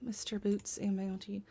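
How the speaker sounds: soft unvoiced whisper. Real, not acted. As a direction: breathe the name, barely voiced. Reading a name to herself.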